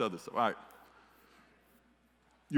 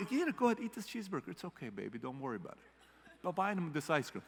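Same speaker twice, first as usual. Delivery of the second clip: doting grandparent; 2 semitones higher, 8 dB quieter than usual. Retold or imitated. Grandparent spoiling grandkid with treats, mock cooing. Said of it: "you know".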